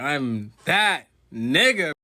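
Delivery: swaggering, drawn-out brag; cocky drawn-out anime boast; slow, loud boast. Retold or imitated. Boastful character voiced.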